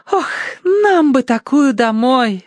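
high, slow wistful rapture; Tossie. A narrator's reading. Tossie wanting one at home, rapturous longing. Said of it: "всплеснула руками Тосси".

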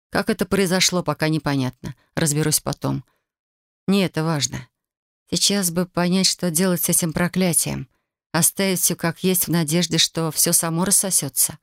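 ordinary delivery, nothing marked

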